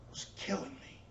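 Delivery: a near-voiceless broken whisper; the line dies in the throat; voice breaking. Retold or imitated. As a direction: let the words crack and fall away. Mother's quote breaking off.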